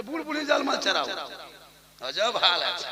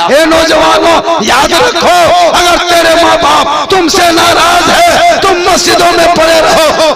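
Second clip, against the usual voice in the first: full-force preaching; 7 semitones higher, 17 dB louder than usual. Real, not acted. Preacher warning young men about displeased parents, full-force preaching. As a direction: thunder the warning at the congregation.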